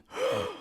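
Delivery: sharp high intake of breath in shock; sudden airy gasp of horror. Retold or imitated. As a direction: gasp as if you just saw it. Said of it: "total shock".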